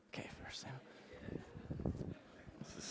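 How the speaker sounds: whispered aside to himself. Real, not acted. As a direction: murmur it under your breath. Aside while finding the passage.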